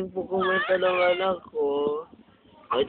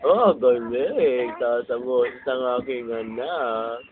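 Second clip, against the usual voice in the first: slow praise; 7 semitones lower, slower than usual. Real, not acted. Praising baby's singing.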